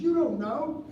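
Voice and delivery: high, insistent mother; mother's insistence. Retold or imitated. Mother lecturing her young son, high and insistent. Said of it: "She says".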